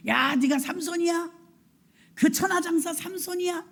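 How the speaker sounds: high-pitched jeering at a fallen strongman; mocking taunt. Philistines jeer at captive strongman Samson, mocking. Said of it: "이렇게 조롱했어요".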